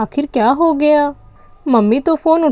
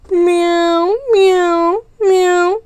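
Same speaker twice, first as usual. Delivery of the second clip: cat meowing; 4 semitones higher than usual. Acted. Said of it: "इतनी ज़ोर ज़ोर से क्यों चिल्ला रही है".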